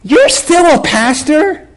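high, slow, disbelieving question; mock incredulity. Annoying woman learns he is a pastor, incredulous. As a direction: imitate her drawn-out, condescending surprise. Woman doubts his ministry.

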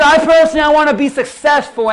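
loud, high mock of her boast; mocking shout. Preacher mocking the woman's boast to the church, loud and high. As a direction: belt her words back at the crowd. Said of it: "She said this".

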